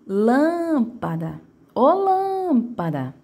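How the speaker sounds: "lâmpada" called with LÂM stretched long; drawn-out call, long high LÂM in "lâmpada"; calling out the word. Shown as a demonstration. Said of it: "vamos fazer o teste do chamamento".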